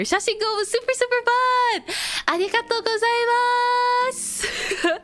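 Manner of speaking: high, bright thanks; bubbly farewell; thrilled goodbye; excited sign-off. Real, not acted.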